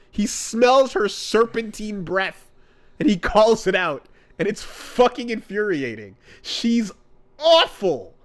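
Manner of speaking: usual speaking voice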